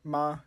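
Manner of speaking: MA held high and level; first tone. Shown as a demonstration. Teacher gives the word for mother, "ma" high and level. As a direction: sustain "ma" on a high note. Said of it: "So the first tone".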